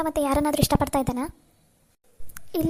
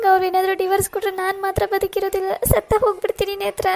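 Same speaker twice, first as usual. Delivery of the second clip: tearful pleading, pitched up and louder; crying; 4 semitones higher, 3 dB louder than usual. Acted. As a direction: sob the words out in despair. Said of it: "ಸವಿ, ಅಳಬೇಡ ಕಣೆ".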